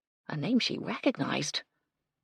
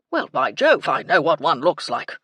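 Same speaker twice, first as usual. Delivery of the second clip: put-on loud old-soldier bluster; blustery colonel; 9 dB louder than usual. A narrator's reading. Colonel asserting he knows pythons, loud bluster. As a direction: burst out like an indignant old officer.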